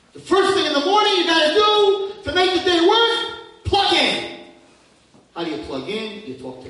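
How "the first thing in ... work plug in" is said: high, loud, slow sung-out prayer; preaching at full force. Acted. Preacher urging listeners to pray every morning, loud and high. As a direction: cry the words out to the congregation.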